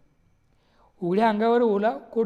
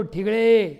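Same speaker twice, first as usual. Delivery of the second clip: a loud, exasperated call of the name; fed-up shout; 6 dB louder, much slower than usual. Retold or imitated. Customer yells at the tailor in exasperation, loud. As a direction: bark his name out of patience. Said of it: "मी वैतागून ओरडलो".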